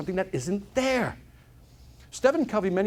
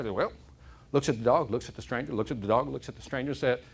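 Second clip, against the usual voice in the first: ordinary delivery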